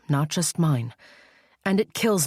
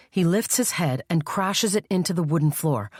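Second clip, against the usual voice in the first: ordinary delivery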